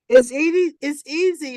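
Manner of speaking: first easy pushed out loud; tongue in cheek; wry emphasis. Real, not acted. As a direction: ironic it's easy. Ironic remark after instruction.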